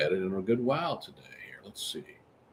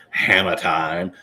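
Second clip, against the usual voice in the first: catchphrase; 3 semitones lower, 4 dB louder, slower than usual. Pretend. Catchphrase said on a slowed drawl.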